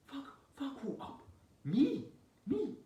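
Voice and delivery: very high, incredulous aunt imitation; mock outraged disbelief. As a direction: squeal it like an affronted aunt. Aunt's outraged reply, acted out.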